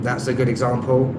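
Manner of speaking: ordinary talk, nothing marked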